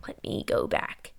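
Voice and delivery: barely audible repeat; trailing off whisper; fainter whisper. Real, not acted.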